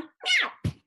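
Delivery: high, loud meow; loud cat meow. Pretend. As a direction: belt out a big cat meow. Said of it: "Ready? Three, two, one".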